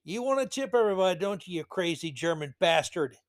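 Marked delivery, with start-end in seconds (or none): none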